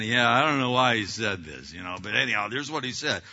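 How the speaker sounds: mock dismissive, eye-rolling delivery; eye-rolling disgust. Staffer rolling eyes at the pastor's message, mock disgusted. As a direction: sigh it off like you don't buy it. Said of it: "they roll their eyes while they're saying".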